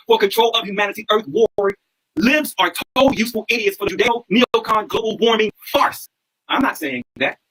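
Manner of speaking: normal talking voice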